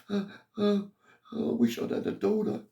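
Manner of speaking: high, tearful voice breaking on the uh's; retelling a crying mother's words in a high wail; imitating mum crying. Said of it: "She started crying".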